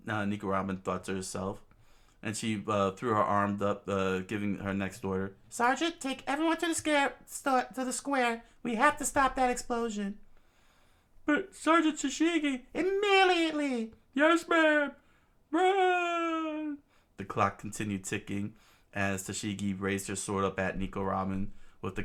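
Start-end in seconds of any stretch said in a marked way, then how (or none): 5.5-16.8 high, loud hurried commands; urgent high orders. Acted. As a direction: shout orders fast.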